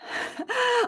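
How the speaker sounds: bursts out laughing, high; a startled happy laugh breaking off the answer; sudden burst of laughter. Real, not acted. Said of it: "I just got distracted".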